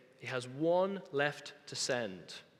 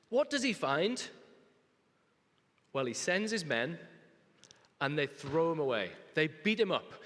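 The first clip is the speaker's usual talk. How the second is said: normal talking voice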